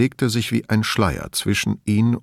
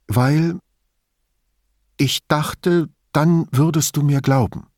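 slightly plaintive, higher voice with halting pauses; lightly voiced hesitant confession; 5 semitones higher than usual. A narrator's reading. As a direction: explain yourself haltingly, a little higher. Elisabetta hesitantly explains herself like caught schoolgirl.

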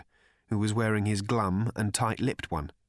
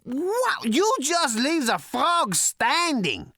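very high, loud, slow, near screaming; hysterical glee; 5 dB louder, slower than usual. Acted. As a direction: rave in giddy hysterics. Prak raves, hysterical with glee.

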